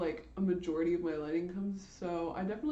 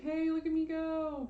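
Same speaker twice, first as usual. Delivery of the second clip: mock showing off; 8 semitones higher than usual. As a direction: sing out a proud little boast, mocking yourself. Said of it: "it's six people and I'm like".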